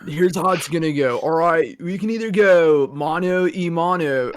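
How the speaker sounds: aggressive SoCal surfer dude; socal accent. Acted. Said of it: "be the aggressively Southern Californian".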